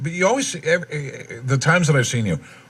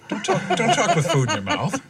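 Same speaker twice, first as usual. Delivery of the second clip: laughing; 10 semitones higher than usual. Real, not acted. Teasing scolding of a chewing cohost.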